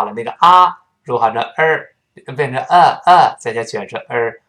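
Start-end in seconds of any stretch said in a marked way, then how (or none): none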